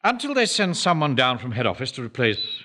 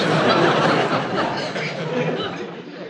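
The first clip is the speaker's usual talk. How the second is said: gleeful laugh; 6 semitones higher than usual. Acted. Manager gloats over intrigue.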